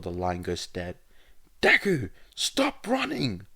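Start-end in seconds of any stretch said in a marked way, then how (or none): 1.6-3.5 high, loud shout, last words drawn out. Acted. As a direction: yell it down the line, much higher. Caller shouting over the phone.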